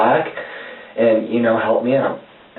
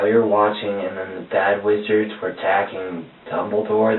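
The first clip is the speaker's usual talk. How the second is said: ordinary delivery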